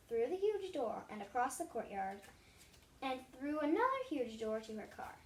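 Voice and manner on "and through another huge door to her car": plain reading voice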